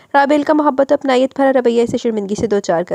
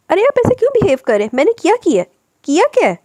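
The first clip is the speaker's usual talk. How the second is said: high, loud wounded questioning; stung, pleading outburst, pitched high; anguished protest; 10 semitones higher, 5 dB louder than usual. A narrator's reading. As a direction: cry out in hurt at the insult. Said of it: "बेइज्जती पर तड़प कर इस्तफसार किया".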